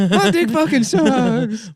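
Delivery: laughing mock whine. Mocking the gamer while laughing, high and whiny. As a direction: crack up mid-mockery. Mocking an adult Pokemon fan.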